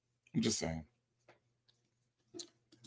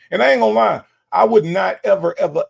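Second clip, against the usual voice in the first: emphatic; 7 semitones higher, 6 dB louder, faster than usual. Real, not acted. Emphatic declaration.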